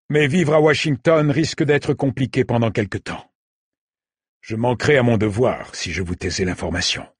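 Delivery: a kindly officer breaking bad news. A narrator's reading. A lightly voiced officer warning the refugees, polite.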